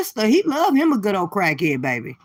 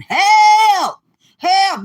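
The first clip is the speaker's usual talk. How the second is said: high, loud mock scream; hollering liver; 11 semitones higher, 9 dB louder than usual. Mocking a rival's liver, hammed-up hollering. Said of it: "over there is hollering".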